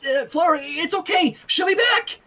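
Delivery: from nervous comfort to high frantic cry; frantic soothing. Acted. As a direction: reassure the wailing baby while panicking. Soothing baby, getting frantic.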